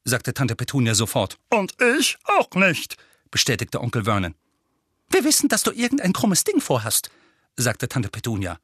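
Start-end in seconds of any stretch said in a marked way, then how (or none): 1.5-3.0 slow, high, strained emphatic agreement; heavy, drawn-out disbelief; uncle agreeing. A narrator's reading. Uncle backs up aunt. 5.1-7.1 high-pitched accusation; suspicious aunt in a high voice; accusing aunt. A narrator's reading.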